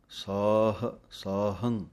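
ਸਾਹੰ with the ਆ drawn out long; long aa demo. Shown as a demonstration. Teacher shows students a long ਕੰਨਾ vowel. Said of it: "ਸਸੇ ਦੇ ਕੰਨੇ ਨੂੰ ਲੰਮਾ ਬੋਲਣਾ ਹੈ".

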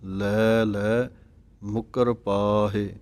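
very slow, with a pause before ਲੈ ਲੈ. Shown as a demonstration. Teacher repeats the line with a pause after ਕੇਤੇ. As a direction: rest after ਕੇਤੇ, then ਲੈ ਲੈ ਮੁਕਰ ਪਾਹੇ slowly. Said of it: "ਕੇਤੇ ਦੇ ਉੱਤੇ ਵਿਸਰਾਮ ਲਗਾ ਕੇ".

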